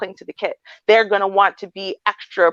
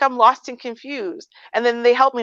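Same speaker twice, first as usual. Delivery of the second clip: normal talking voice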